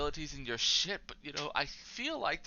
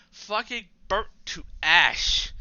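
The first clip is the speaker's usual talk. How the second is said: slow, louder, each word pushed out; angry outburst; 3 semitones higher, 8 dB louder, slower than usual. Real, not acted. Ranting to viewers about his year, angry slow burst. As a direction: spit it out in frustration.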